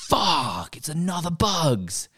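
high, drawn-out cry of mock dismay; mock exasperation. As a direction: groan it out high and slow, hamming up the letdown. Acted dismay at a repeat find.

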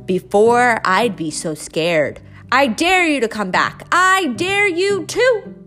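taunting dare. Acted. Biggest mouse dares his siblings to return, loud jeering taunt. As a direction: goad them to come back. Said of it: "said the biggest little mouse".

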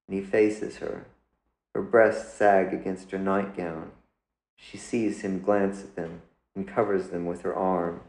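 ordinary delivery, nothing marked

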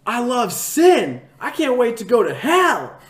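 high, loud, drawn-out taunting yell; shouted mock confession of sin; gleeful yell. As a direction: bellow it at the preacher to mock him. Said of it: "and I just yell at".

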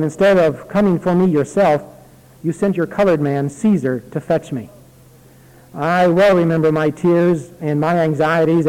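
put-on earnest reading of an old letter; letter read aloud. Pastor reading a dying aunt's letter aloud, earnest reading. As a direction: read it out heartfelt to the congregation.

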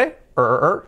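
"er" repeated as a held English r; isolated R sound. Shown as a demonstration. Teacher breaking the word down, isolated R sound. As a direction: drill the "er" sound alone. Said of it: "pero acaba en R".

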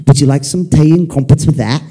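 mock English accent, playfully put on. Preacher joking about the English offering tea, mock English accent. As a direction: play a tea-serving English host. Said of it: "if you're English".

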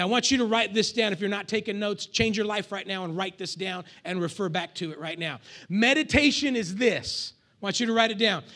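ordinary talk, nothing marked

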